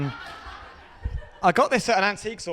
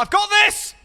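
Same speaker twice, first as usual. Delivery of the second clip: high, loud bragging yell; a mate hyping himself up to fight; cocky shout; 7 dB louder than usual. Acted. Karate mate in a street fight, high cocky shout.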